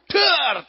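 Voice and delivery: loud, high shouted bang; explosive mock gun blast; imitated gunshot. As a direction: fire off a drawn-out vocal gunshot.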